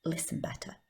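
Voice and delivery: suddenly hushed, intent whisper; very soft, deliberate whispering; commanding whisper. Shown as a demonstration. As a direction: drop to a whisper so they lean in. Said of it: "if you whisper".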